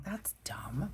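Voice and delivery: hushed, voiceless little quote; whispered aside. Retold or imitated. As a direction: breathe it like a secret. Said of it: "I'm like".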